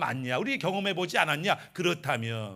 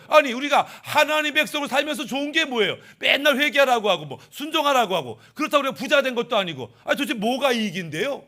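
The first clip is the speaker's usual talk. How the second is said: higher-pitched, pushy acted grievance; grumbling complaint; 4 semitones higher, 4 dB louder than usual. Israel's people complain to God about no benefit, grumbling. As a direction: air a people's discontent, voice raised in pitch. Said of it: "하나님께 지금 불만을 토로하는".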